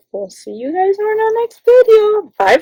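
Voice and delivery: high bright outro; sing-song sign-off. Real, not acted. Vlogger ending her video, sing-song goodbye. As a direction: wave goodbye with your voice.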